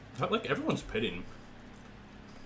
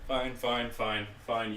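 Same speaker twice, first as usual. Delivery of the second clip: normal talking voice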